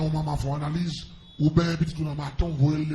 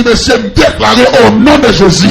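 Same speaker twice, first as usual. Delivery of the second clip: loud fervent preaching, voice raised; preaching at full force; 4 semitones higher, 12 dB louder than usual. Acted. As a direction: shout the testimony to the church. Preacher shouting testimony to congregation. (prédication criée avec ferveur)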